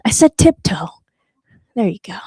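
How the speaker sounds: hushed, voiceless whisper. Real, not acted. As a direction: whisper it playfully. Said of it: "tiptoe over here".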